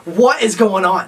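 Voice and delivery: loud, startled call from bed; half-asleep roommate's sudden loud demand; woken roommate shouting. Retold or imitated. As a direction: yell it like you were just woken up.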